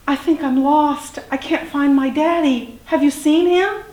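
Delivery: high, pleading youngster voice; lost little dinosaur. A narrator's reading. Little dinosaur asks a stranger for help, high pleading.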